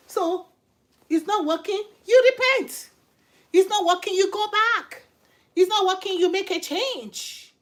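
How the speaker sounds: high, loud preacher's call; emphatic exhortation. Real, not acted. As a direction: punch each command out high and loud. Preacher exhorting the audience to repent.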